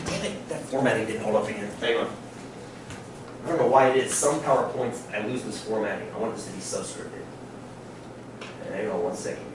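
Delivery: quiet annoyed aside while fixing formatting; muttering to himself. Real, not acted. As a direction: grumble to yourself at the slide. Teacher mutters over PowerPoint formatting.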